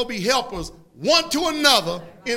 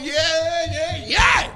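raised, drawn-out cheer building up; excited cheering; 6 semitones higher, 3 dB louder, much slower than usual. Pretend. Imagined listener cheering Jesus on, excited whooping. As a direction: egg the speaker on, helplessly thrilled. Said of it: "I couldn't help but sitting there going".

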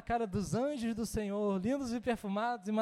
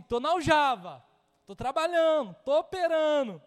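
loud, energetic declaration; eager reply; 5 semitones higher, 7 dB louder than usual. Retold or imitated. Believer in the quiver answering the encourager: loud, eager. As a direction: insist you're ready and working.

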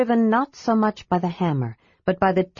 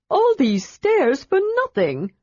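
high, exasperated kender; dismayed kender; 12 semitones higher than usual. A narrator's reading. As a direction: wail it in dismay. Tass dismayed.

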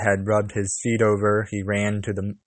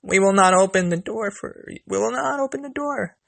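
put-on very high little-kid voices; crying out; 11 semitones higher than usual. A narrator's reading. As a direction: pipe up high in refusal like little goat kids. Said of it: "cried they".

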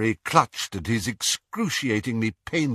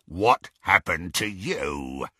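gloating werewolf. Acted. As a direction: savour every word like prey. Greyback repeats the question.

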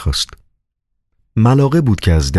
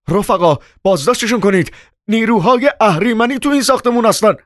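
high, agitated alarm; hysterical cry. A narrator's reading. Chairman calls for arrests of evil forces, hysterical. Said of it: "با حالتی عصبی فریاد زد".

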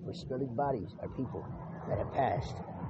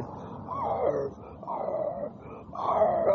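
imitated dog whimper; 8 semitones higher, much slower than usual. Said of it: "start crying".

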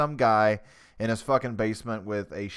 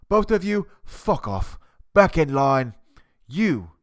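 a lightly voiced barking head chef, sudden loud bursts; hammed-up TV chef sending cooks back to line; mock Gordon Ramsay dismissal; 3 semitones higher, 5 dB louder, slower than usual. Podcaster playing Gordon Ramsay dismissing nominees, mock barking chef. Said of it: "impersonating Gordon Ramsay".

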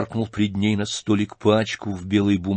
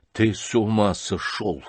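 usual speaking voice

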